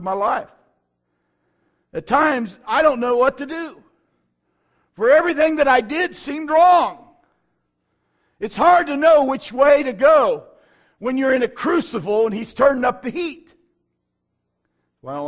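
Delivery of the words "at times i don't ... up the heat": high, loud pulpit delivery; impassioned preaching. Real, not acted. Preacher recalling his own affliction seasons, impassioned. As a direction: preach it out at full force.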